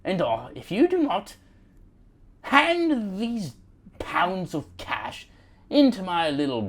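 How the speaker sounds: high-pitched British movie gangster; hammed-up British heist villain; British criminal. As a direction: demand it in a theatrical British crook accent. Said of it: "criminal British guys".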